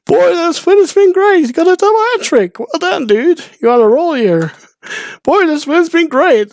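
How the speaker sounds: very high, loud cartoon squeak; shrieking goofy old man, high and loud; squeaky Steve voice. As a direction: push the silly voice to the top. Repeating Steve's catchphrase.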